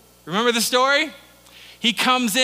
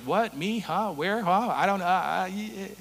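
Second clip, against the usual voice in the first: stammering Moses; 5 dB quieter than usual. Retold or imitated. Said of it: "stuttering and, and stammering and backing up".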